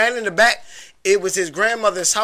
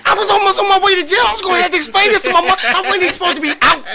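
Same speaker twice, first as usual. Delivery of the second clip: high, fast worked-up; fast excited; 9 semitones higher, 4 dB louder, much faster than usual. Real, not acted. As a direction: rattle it off, worked up. Recalling panic, rushed.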